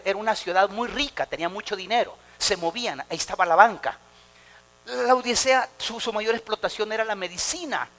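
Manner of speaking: ordinary delivery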